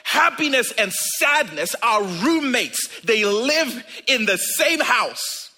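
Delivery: loud, high, impassioned sermon delivery; full-force preaching. Acted. Preacher urging the congregation about joy and sorrow, loud and fervent. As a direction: preach it out to the back row.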